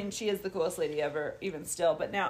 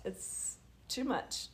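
tired whisper; 3 semitones higher, 9 dB quieter, much slower than usual. Real, not acted. Exhausted mom admitting she's tired.

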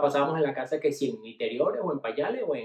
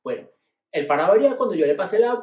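normal talking voice

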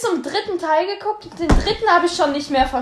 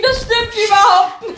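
laughing, higher denial; laughing protest; 7 semitones higher than usual. Real, not acted. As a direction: giggle through the denial.

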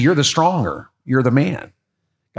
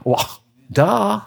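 sarcastic retort; 7 semitones higher than usual. Real, not acted.

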